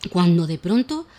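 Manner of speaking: put-on lively, animated reading of a children's tale; expressive children's story voice; storytelling for children. A narrator's reading. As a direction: tell it like a bedtime story for little ones.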